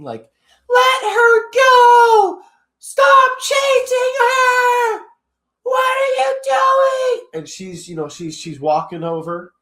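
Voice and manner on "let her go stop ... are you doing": drawn-out, high-pitched mock scream; imitated screaming. Retelling a girl screaming at him during a chase, mock screaming. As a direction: scream it very slowly, loud and high, imitating a protester. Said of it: "girl's just screaming".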